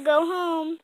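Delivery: usual speaking voice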